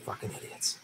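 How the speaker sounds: real whispered curse; angry whisper. Real, not acted. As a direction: curse barely voiced. Muttering at election results.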